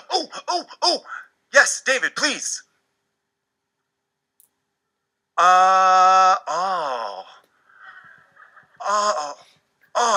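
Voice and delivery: high eager oh oh, then lost; student voice, high then stalled; eager student blanking. Acted. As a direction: hand up and keen, then the mind goes empty. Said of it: "allow me to demonstrate".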